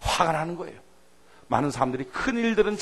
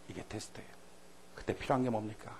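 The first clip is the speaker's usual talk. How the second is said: ordinary talk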